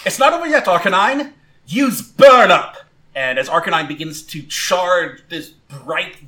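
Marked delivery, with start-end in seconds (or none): 0.0-2.8 high, loud battle command; rally, then hit the move hard; rallying shout. Acted. Trainer urges Arcanine to use Burn Up, shouting. Trainer rallies and orders attack.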